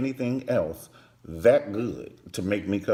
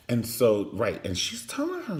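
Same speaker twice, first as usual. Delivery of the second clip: usual speaking voice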